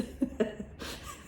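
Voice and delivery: breathless stifled giggle; laughing. Real, not acted. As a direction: let a quiet, airless laugh escape. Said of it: "sorry for laughing".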